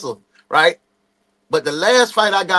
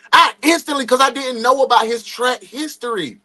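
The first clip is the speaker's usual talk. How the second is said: ordinary delivery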